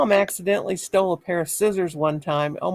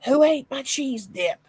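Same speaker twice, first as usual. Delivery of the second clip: slow, higher mock husband voice; husband's demand; 7 semitones higher, slower than usual. Husband demanding to know who ate his cheese dip, mock indignant. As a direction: drawl out his complaint. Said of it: "My husband said".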